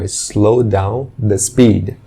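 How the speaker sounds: "slow down the speed" drawn out slowly; slow example. Shown as a demonstration. Teacher slowly reads a redundant example phrase. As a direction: stretch each word of "slow down the speed". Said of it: "Não seria necessário falar".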